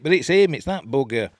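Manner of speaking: high, loud outburst; loud exclamation. Real, not acted. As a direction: call out the culprit loudly. Exclaiming at the devil.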